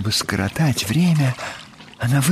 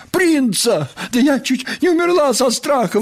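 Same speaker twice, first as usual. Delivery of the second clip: high, fast outraged outburst; indignant; 13 semitones higher than usual. Acted. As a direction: splutter with outrage. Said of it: "возмутилась Нюша".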